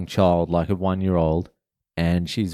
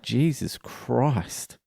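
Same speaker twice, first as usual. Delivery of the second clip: slow, soft, higher-pitched dismay; appalled; 4 semitones higher, 6 dB quieter, much slower than usual. Retold or imitated. Hearing a shop worker's double shifts, quietly appalled. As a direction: breathe it out slowly in quiet shock. Reacting to man's punishing work hours.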